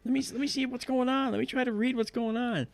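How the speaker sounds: high, loud, hurried objection; retold frantic plea to wait; mock urgent protest. Acts out stopping her panicked shutdown.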